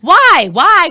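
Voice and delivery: high, loud exasperated why. Real, not acted. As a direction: protest it loudly. Outraged at the name change.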